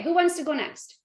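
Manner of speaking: ordinary talk, nothing marked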